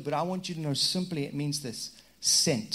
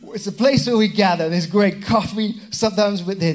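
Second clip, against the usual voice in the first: loud, raised comic churchgoer voice; hammed-up character voice; 5 semitones higher, 8 dB louder than usual. Churchgoer explaining what church is about, loud hammed-up voice. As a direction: play up the enthusiastic answer.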